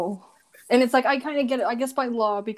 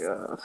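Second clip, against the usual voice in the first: low, drawn-out "ugh"; disgusted groan; 14 semitones lower, 7 dB quieter than usual. Acted. Reacting to this guy after traumatic story, disgusted groan.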